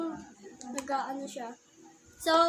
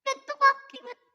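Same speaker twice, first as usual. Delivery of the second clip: pitched up high through the mixer; pitch bend voice effect; 12 semitones higher, 5 dB louder than usual. Shown as a demonstration. Vlogger showing viewers the pitch bend effect, high pitched. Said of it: "ganito yung boses ko".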